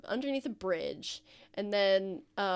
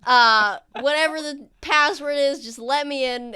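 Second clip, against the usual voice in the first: louder, higher mock-solemn password call; 5 semitones higher, 10 dB louder than usual. Fingers calling through the door, louder mock password. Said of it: "a very familiar voice go".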